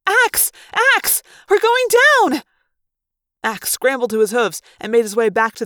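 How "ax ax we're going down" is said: very high, loud shouting for help; panicked cries as the ship falls; frantic yell. A narrator's reading. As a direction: yell desperately to the pilot. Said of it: "Rachel yelled".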